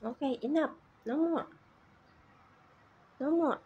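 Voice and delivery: stern loud enough. Real, not acted. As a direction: put your foot down with the pet. Telling the bird to stop.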